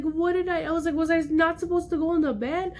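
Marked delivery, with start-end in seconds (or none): 0.0-0.6 high-pitched, mildly tearful protest; retold crying. Child defending herself to mom, mock crying. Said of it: "started profusely crying 'cause I was like". 1.0-2.7 high, mildly plaintive question; retold crying. Child asking mom what went wrong, mock crying. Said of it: "started profusely crying 'cause I was like".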